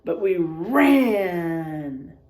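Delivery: put-on hushed, drawn-out storytelling to children; spooky story narration. A narrator's reading. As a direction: read it like a campfire tale.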